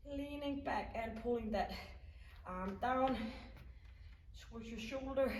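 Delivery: usual speaking voice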